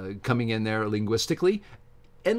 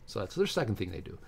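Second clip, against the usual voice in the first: ordinary talk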